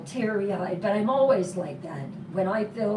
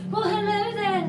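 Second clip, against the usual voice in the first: much higher, teasing hello; playful sing-song greeting; 13 semitones higher than usual. Real, not acted. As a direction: swoop up high to greet him warmly. Playful greeting to chat viewer.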